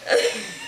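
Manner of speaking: squeal of laughter. Real, not acted. She laughs at the cat.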